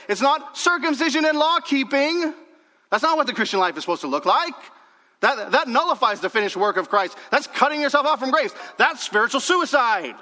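high, loud proclamation; full-force preaching. Real, not acted. Preacher declares Paul's warning to the church, forceful preaching. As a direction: drive each phrase home from the pulpit.

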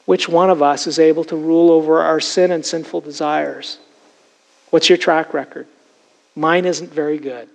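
usual speaking voice

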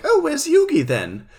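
high-pitched British questioning; put-on British lilt, pitched high; posh British accent. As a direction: ask it as a mock posh Brit. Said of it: "his posh British accent".

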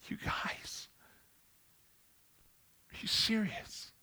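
hushed, disbelieving aside; incredulous whisper. Retold or imitated. Paul whispering to bickering listeners, incredulous. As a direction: whisper it in disbelief, voice lifting at the end. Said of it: "Paul sitting there going".

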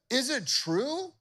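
high, slow questioning; king confronting. Acted. King confronting the three men, demanding. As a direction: demand it slowly of them. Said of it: "Nebuchadnezzar said".

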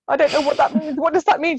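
high, loud bewildered question; baffled and thrown; confused alarm. Retold or imitated. Baffled by midwife.

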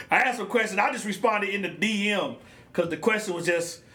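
ordinary delivery

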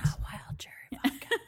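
conspiratorial whisper. Real, not acted. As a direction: whisper it as a secret.

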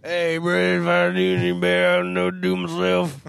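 put-on loud slurred bro drunk voice. Shown as a demonstration. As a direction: slur it all into one word, loudly. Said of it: "the drunk voice that we have".

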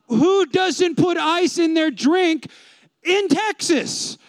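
high, very slow disbelief; incredulous. Real, not acted. Pastor to crowd, outraged at no ice. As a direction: stretch it out in outrage.

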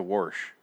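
"wash" with an intrusive R, "warsh"; regional "warsh". Retold or imitated. Scoffing at the local word, "wash" said as "warsh". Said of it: "There's no R in that word".

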